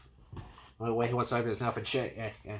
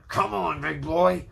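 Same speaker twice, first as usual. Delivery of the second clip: higher, louder taunting call; yelled taunt; 5 semitones higher, 5 dB louder, slower than usual. Retold or imitated. Hunter taunts the charging dragon, loud yelled challenge. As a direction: dare the beast to come at him. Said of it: "he yells out to the dragon".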